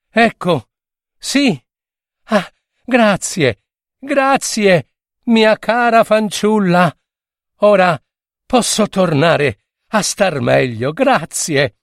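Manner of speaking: high, breathless thanks from a rescued dolphin; the grateful dolphin, voice lifted high in relief; relieved dolphin. A narrator's reading. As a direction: gush your thanks like you just escaped death.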